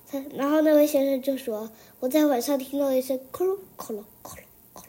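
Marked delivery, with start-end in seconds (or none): 3.3-4.8 fast whispered clicking noise; mimicking a strange night noise; creepy clicking whisper. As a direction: rattle it off in a breathy whisper. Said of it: "的声音".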